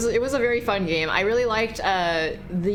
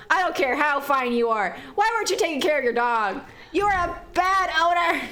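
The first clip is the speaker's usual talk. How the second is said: indignant scolding; 6 semitones higher than usual. Real, not acted. Scolding the witch.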